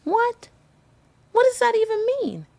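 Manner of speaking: very high, squeaky disbelief; shocked disbelief. Retold or imitated. Told he only bought the assets, a very high shocked squeak. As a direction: shriek it out, totally thrown. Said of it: "And I was like".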